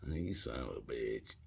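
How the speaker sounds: low, soft, grudging admiration. Retold or imitated. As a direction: mutter it as the joke lands. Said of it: "I'm like".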